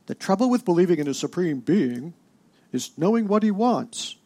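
a hint of a grand Starfleet captain; Picard impression. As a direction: lift it into a lightly voiced commanding captain. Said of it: "the way he can say it".

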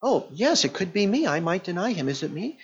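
a hint of nervous self-doubt, dying away; mock doubting Peter. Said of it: "Peter isn't thinking".

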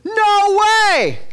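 high, loud shocked protest; Peter protesting. Retold or imitated. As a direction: Peter shrieks in disbelief. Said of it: "And he goes".

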